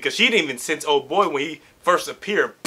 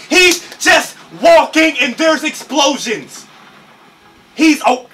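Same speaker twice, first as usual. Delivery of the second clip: loud, high, stretched-out disbelief; amazed yelling; 11 semitones higher, 7 dB louder, slower than usual. Real, not acted. Viewer amazed at explosions from just walking, excited yelling. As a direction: shout it slowly in amazement.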